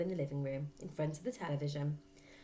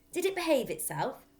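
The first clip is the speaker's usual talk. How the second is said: Fred's voice; 9 semitones higher, 7 dB louder than usual. Acted. Fred voiced.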